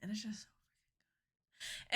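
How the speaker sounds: hushed whisper of delight; excited whisper. Real, not acted. Streamer gushing to chat about MasterChef, whispered. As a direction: breathe it like a secret treat.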